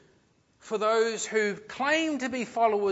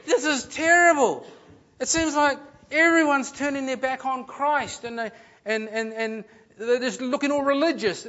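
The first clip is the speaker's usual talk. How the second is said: higher, louder, dismayed outcry; alarmed voice of the writer; 6 semitones higher, 8 dB louder than usual. Retold or imitated.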